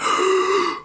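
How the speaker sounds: sharp, sudden gasp; acted gasp. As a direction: suck in air as if jolted up. Jolting up from the trip.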